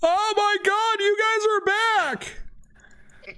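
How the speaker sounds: very high, squealing character voice; excited mage. Acted. Transformed mage greeting returning adventurers, high excited squeal. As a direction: cry it out far above your own pitch. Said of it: "And he's like".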